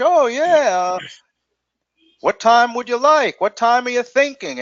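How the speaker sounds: clueless rep. Retold or imitated. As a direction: parody an unsure receptionist fishing for a time. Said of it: "I mean, you know".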